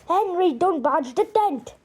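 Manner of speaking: dad yelling at son; yelled warning. A narrator's reading. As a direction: yell at Henry to stop. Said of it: "yelled Dad".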